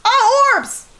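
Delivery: surprised. Real, not acted. Spotting ghost orbs.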